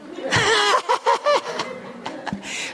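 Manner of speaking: real laughing fit; high burst of laughter. Real, not acted. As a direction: break into laughter at the joke. Laughing at own sketch.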